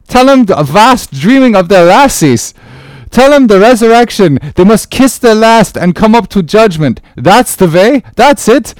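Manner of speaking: high, loud gruff sailor chatter; jolly Dutch sailor. Acted. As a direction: egg the men on teasingly.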